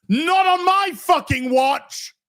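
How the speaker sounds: high, slow mock shout. Mimicking the streamer defiantly refusing the viewer, hammed-up shout. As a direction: bellow it like an outraged streamer. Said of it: "says D S P".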